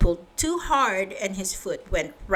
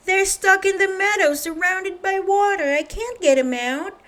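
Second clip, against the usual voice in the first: high, louder worried farmer voice; 9 semitones higher, 3 dB louder than usual. Acted. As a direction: plead for help with the sheep, anxious and high. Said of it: "said Farmer Fields".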